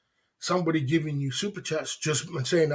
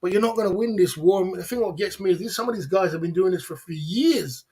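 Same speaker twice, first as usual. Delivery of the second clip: normal talking voice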